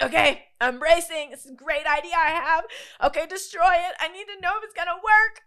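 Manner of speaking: nervous bracing. Retold or imitated. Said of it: "I was like".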